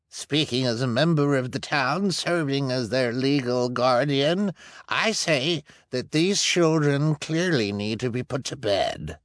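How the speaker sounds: high, pompous speech; smug official. Acted. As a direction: speak with false authority. Luciana addresses the room.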